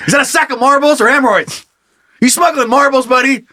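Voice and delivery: high-pitched mock interrogation; suspicious hunter. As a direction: accuse him like an over-the-top cop. Hunter frisking suspect in skit.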